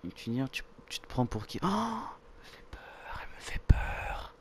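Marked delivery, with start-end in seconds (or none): 1.6-4.3 slow, breath-only whisper; scared whisper. Real, not acted. Player frightened by Yuri on screen, scared whisper. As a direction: whisper it slowly in dread.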